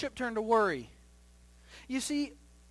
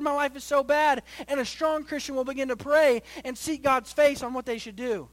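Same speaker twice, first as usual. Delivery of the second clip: loud, raised preacher's delivery; impassioned preaching; 5 semitones higher, 9 dB louder than usual. Acted. Pastor contrasting praying Moses with complainers, impassioned preaching. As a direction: press the contrast home with driving force.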